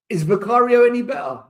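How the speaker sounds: loud, high challenge; exasperated shout. Real, not acted. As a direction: shout it at the screen. Fan exasperated at keeper.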